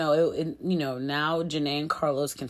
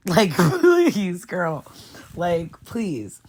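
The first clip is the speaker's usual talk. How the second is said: exasperated scoff; 6 dB louder, much slower than usual. Real, not acted. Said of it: "mocking contestant's attitude".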